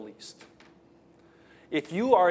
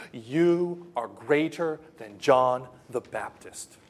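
ordinary talk, nothing marked

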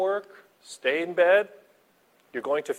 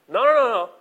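louder and higher, firm rejection; emphatic no; 9 semitones higher, 6 dB louder, faster than usual. Real, not acted. Pastor forcefully rejecting trying to comfort yourself. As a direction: cut them off, no way.

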